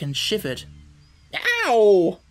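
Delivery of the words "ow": sudden loud, high yelp of pain; startled hen's loud ouch; pained cry. A narrator's reading. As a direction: cry out sharply "ow". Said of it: "she cried".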